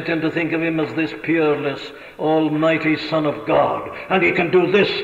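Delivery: ordinary delivery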